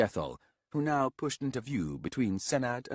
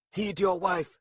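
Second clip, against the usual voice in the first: put-on young warrior voice; 10 semitones higher, 4 dB louder than usual.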